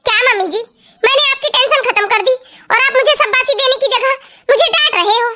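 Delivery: ordinary talk, nothing marked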